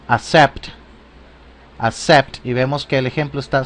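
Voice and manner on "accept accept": "accept" said as a clear model word; English pronunciation demo. Shown as a demonstration. Teacher models the verb for learners, "accept" pronounced clearly. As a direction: say "accept" carefully, ending on a rise. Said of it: "En inglés se pronunciaría".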